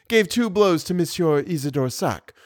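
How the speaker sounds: usual speaking voice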